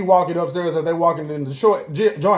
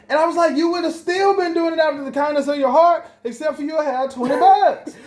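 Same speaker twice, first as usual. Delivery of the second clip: higher, worked-up comeback; a touch of mock outrage; indignant retort; 10 semitones higher than usual. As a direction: argue it, amused and pitched up.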